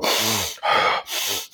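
long, soft breath out; deep calming exhale. Shown as a demonstration. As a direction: let a slow breath go to calm down. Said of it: "I take some deep breaths".

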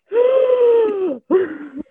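loud, high mock gasp of horror; hammed-up shocked gasp; horrified gasp. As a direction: gasp like you've seen a murder victim. Imagining employee's horror.

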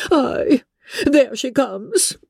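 high, self-pitying matron voice; fretful mother, high and put-upon; aggrieved Mrs Bennet. A narrator's reading. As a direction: play the wronged mother of nerves.

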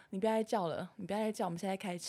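calm sister. Retold or imitated. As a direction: hush her firmly but gently. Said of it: "因为我姐很冷静嘛".

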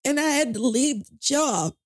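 high, strained, holding back tears; emotional, voice breaking. Real, not acted. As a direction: push through rising tears. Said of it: "forgive me for getting so emotional".

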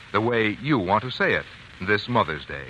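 ordinary talk, nothing marked

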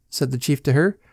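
ordinary delivery

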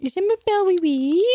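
very high French dog voice; squeaky, cartoonish French accent; [name], French accent. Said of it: "he will be speaking to us in the accent".

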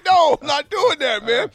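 normal talking voice

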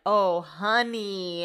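slow, drawn-out "Oh, honey" of pity; mock sympathetic masseuse. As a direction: stretch it out like a caring masseuse. Masseuse sympathizes with tight body.

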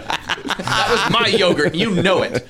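hammed-up co-host grievance; mock petty quarrel. Co-host joins the imagined booth bickering, mock indignant.

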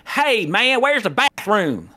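high, drawn-out Southern call; Southern accent. Shown as a demonstration. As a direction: ask it in a slow Southern drawl. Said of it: "we're from the South".

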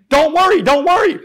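high, loud yell thrown back at the cop; screaming back. Retold or imitated. Speaker screams back at the cop over him, loud yelling. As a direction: shout the reassurance right back at him. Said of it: "I screamed back at him".

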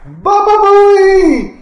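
high, drawn-out gallery yell; long high-pitched mock heckle; heckler's shout. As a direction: holler it like a crowd heckler. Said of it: "somebody in the back going".

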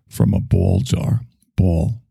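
"ball" stretched in a New York accent, "pɔl". Shown as a demonstration. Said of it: "Hear my New York accent".

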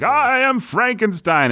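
high, over-the-top monster actor; hammy Frankenstein. Retold or imitated. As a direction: ham it up like a stage monster. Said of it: "amping Frankenstein up to eleven".

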